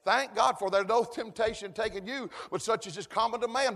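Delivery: ordinary delivery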